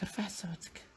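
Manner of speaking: whispered mock request to speak up. Shown as a demonstration. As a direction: whisper it as an ironic joke. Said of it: "ما رانيش نسمعك".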